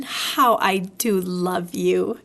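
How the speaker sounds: low, slow, tender declaration; fond Christopher Robin. A narrator's reading. Christopher Robin to Pooh going home, tenderly. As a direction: linger lovingly over each word.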